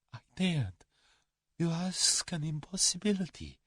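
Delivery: high, soft fearful Russian; frightened refusal. A narrator's reading. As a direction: shrink from answering. Cornered coward refuses to tell.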